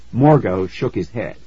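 ordinary delivery, nothing marked

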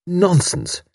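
a brisk dismissal; robustly. A narrator's reading. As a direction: cut him off firmly. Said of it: "she said robustly".

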